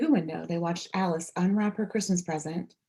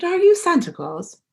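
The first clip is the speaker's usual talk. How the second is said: eager question; 12 semitones higher, 7 dB louder than usual. A narrator's reading. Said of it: "he said".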